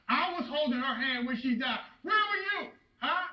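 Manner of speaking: anguished accusation. Acted. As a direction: confront your condemned son, pitch climbing on the questions. Acted father confronting condemned son.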